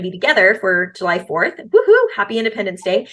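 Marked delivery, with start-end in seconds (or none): 1.6-2.1 high, loud celebratory woohoo; excited cheer. Real, not acted. Host celebrating spending July fourth together, excited cheer.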